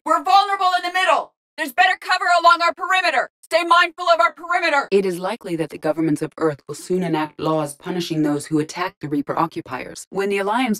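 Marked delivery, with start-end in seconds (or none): 0.0-4.9 high, urgent tactical callouts; sustained high-pitched battlefield call; combat shout. Acted. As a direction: shout warnings to the squad under fire.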